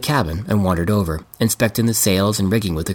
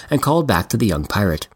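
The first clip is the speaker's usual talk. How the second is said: plain reading voice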